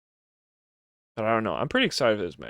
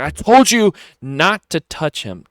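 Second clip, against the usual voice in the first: higher, louder, slow menacing warning; louder Roman Reigns warning; 7 semitones higher, 12 dB louder, slower than usual. Retold or imitated. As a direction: bark it like a furious boss laying down the law. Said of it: "He's like".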